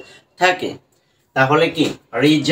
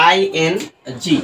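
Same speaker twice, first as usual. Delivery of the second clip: slow teaching; 3 semitones higher, much slower than usual. Shown as a demonstration. Teacher slowly introduces a new spelling. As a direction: slowly present the letters. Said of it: "teacher introduces spelling".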